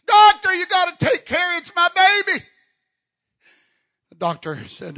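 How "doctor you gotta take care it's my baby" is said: high, loud mock scream of panic; imitated screaming. Preacher acting a snake owner begging the vet, imitated screaming. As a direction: act out a frantic woman screaming for help. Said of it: "She starts screaming".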